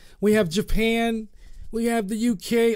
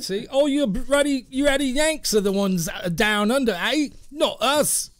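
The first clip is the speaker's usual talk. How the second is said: put-on Australian accent; 5 semitones higher than usual. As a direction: play the mock Aussie bloke, Australian twang. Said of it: "practicing your accent".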